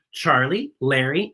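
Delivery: ordinary delivery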